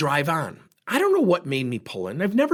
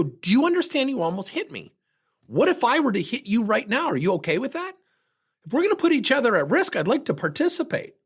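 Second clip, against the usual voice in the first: raised, heated challenge to the driver; angry confrontation; 8 semitones higher than usual. Retold or imitated. Confronting the driver who almost hit him, heated and raised. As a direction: push back hard, voice up. Road rage confrontation retold.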